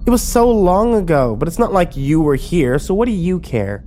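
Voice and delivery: high-pitched, dismissive brush-off; Emma. A narrator's reading. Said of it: "Emma rolled her eyes".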